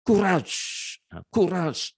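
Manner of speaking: an eager, rallying courage; excited. Shown as a demonstration. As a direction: sound like you're cheering someone on. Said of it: "nah, excited".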